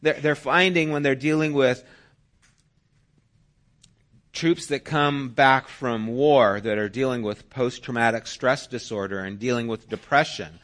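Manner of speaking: ordinary delivery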